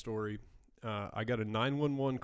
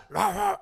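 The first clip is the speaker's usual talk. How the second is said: imitated playful barks; 8 dB louder than usual. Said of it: "this dog was going".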